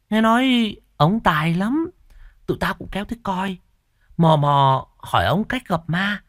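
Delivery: put-on boy telling his friend how to see ghosts, high and eager; boastful village boy. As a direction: tell it like a kid sharing a secret. Tèo tells the ghost story.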